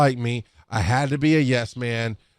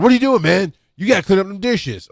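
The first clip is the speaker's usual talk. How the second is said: higher, louder mock telling-off; retold scolding; 7 semitones higher, 4 dB louder than usual. Ronaldo to the narrator leaving over dishes, retold scolding. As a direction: imitate a pushy colleague taking someone to task.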